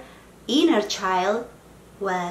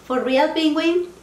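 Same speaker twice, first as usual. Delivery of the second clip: exasperated; 7 semitones higher, 4 dB louder than usual. Real, not acted. Scolding her noisy pet bird.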